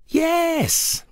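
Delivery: tiny high sprout voice; sprouts' voice. A narrator's reading. Sprouts answer Pumpkin.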